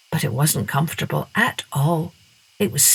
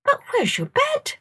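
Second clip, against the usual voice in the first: put-on much higher child's voice; puzzled girl. A narrator's reading. Masha puzzled in the bat's bedroom. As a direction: ask in puzzlement like a small girl.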